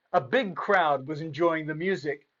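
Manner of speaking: ordinary delivery, nothing marked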